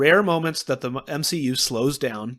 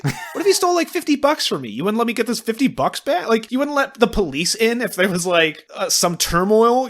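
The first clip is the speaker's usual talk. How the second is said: mock indignant rant; 6 semitones higher than usual. Ranting at the character.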